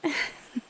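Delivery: a quick airy laugh; breathy laugh. Acted. Amused by the partner's look, breathy laugh. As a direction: laugh under the breath at their face.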